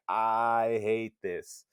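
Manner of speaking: slow, drawn-out mock sulk; sulky Gretel. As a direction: drag out a gloomy complaint, lightly acted. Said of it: "Gretel's just like".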